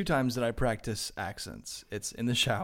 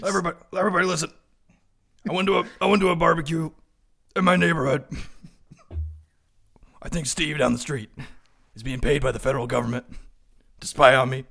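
loud, high, fast paranoid rant; conspiracy ranter; 4 semitones higher, 8 dB louder, faster than usual. Pretend. Neighbor shouting his paranoia to the crowd, loud ranting. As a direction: shout it like a frantic talk-show conspiracy host.

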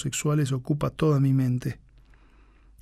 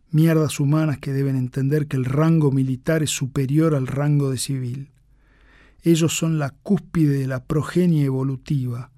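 ordinary delivery, nothing marked

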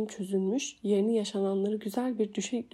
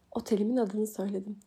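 plain reading voice

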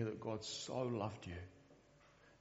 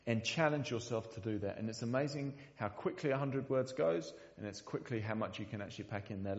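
usual speaking voice

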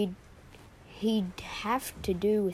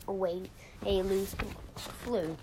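plain reading voice

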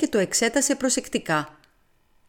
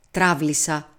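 ordinary delivery

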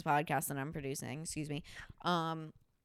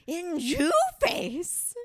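high, very slow, loud put-on whine; mocking the guest; 11 semitones higher, 7 dB louder, much slower than usual. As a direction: stretch it out in her dramatic voice. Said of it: "she was like".

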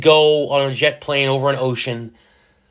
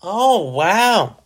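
higher, exaggerated wow; mock amazement; 5 semitones higher than usual. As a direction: feign being impressed. Mocking reaction to boring trip.

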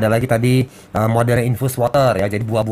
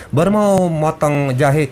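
ordinary delivery

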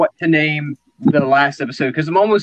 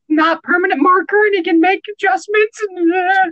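much higher, nervous office underling; nervous employee voice; 10 semitones higher than usual. Acted. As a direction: squeak it out like a scared clerk. Timid employee in office improv.

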